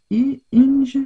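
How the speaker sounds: Inge said the French way, dropping the h of Hinge; French accent on the English color name Inge. Real, not acted. Crafter reading a paint name to viewers, French-accented English. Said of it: "Avec mon accent typique, euh, English".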